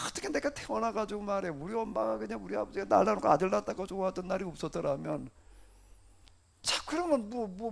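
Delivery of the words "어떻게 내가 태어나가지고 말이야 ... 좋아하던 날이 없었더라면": ordinary delivery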